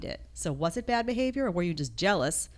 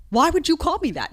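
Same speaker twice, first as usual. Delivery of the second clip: high, loud offended protest; imagined outraged comeback, pitched high and loud; mock indignation; 9 semitones higher, 6 dB louder than usual. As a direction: act out taking offense, voice jumping up. Acting out the expected offended reaction.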